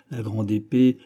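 usual speaking voice

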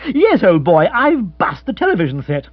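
high, loud upper-class twit; lifted silly-ass voice; dim, moody gentleman. Acted. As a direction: confess it with aggrieved cluelessness.

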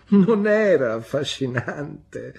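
high, loud, drawn-out mock protest from the mother; laughing exclamation. A narrator's reading. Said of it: "esclamò ridendo". (esclamazione divertita, acuta e forte)

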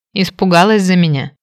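ordinary delivery, nothing marked

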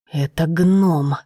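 slow, barked royal outburst. Acted. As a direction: bark it like an enraged king. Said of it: "рявкнул король".

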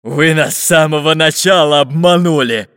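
loud, huffy accusation; puffing pirate. A narrator's reading. Pirate wrenching the chest away, puffing. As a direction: huff it out while wrestling. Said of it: "пыхтел Буль-Буль".

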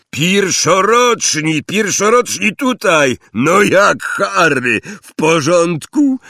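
loud, high calling voice; Hagrid. A narrator's reading. Calling the first-years together on the platform, loud hailing. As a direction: summon a crowd with big, drawn-out calls. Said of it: "znajomy głos".

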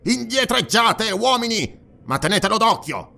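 high-pitched command to the men; called-out order. A narrator's reading. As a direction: call them back, voice pitched high. Dionus orders his men back.

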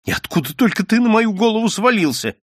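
high exasperated outburst; fed up and furious. Acted. Nadya quarrels with intruder. (раздражённо, высоким голосом)

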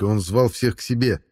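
plain reading voice

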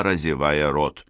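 usual speaking voice

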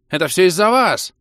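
high, loud squealing accusation; shrill. A narrator's reading. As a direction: pile on in a panicky squeal. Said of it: "визгливо подхватил другой гильд". (визгливо)

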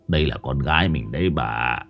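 put-on low, hushed old man's voice; an old father telling his wife he saw their dead daughter; old man recounting. A narrator's reading. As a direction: tell it low and uneasy, like a haunting. Old man tells ghost sighting.

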